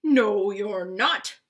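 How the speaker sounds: loud, drawn-out refusal; slow, booming troll voice; stern troll. A narrator's reading. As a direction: forbid it slowly and loudly as a troll. Troll refuses second goat.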